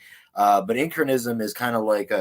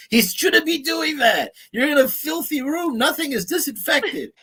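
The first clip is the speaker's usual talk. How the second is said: mock outraged shout; 5 dB louder than usual. Reacting to a friend's dirty tattoo room, mock outraged shout. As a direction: yell it like a scandalised onlooker. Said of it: "And I'm like".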